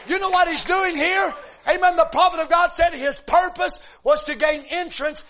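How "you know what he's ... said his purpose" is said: loud, ringing preacher's cry; preaching at full force. Acted. Preacher exhorting the congregation, loud and rising. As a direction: lift the church with it.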